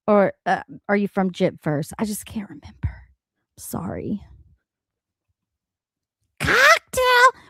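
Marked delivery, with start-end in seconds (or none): 2.0-4.2 soft unvoiced whisper. Real, not acted. Apologizing to chat in a soft whisper. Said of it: "too loud, please let me know". 6.4-7.4 high, loud squealed cocktail; mock shout. Mocking the clip with a shrieked high cheer.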